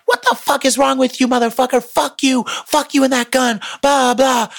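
high-pitched mock yelling; imitated yelling. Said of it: "They start yelling at this dude".

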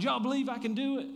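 high pitched call out to the crowd, a touch fast; yelling to the crowd. Retold or imitated. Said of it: "he's yelling to the crowd".

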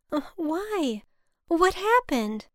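high, anxious questioning. A narrator's reading. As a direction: ask in rising alarm. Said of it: "My heart began to erupt with acute anxiety".

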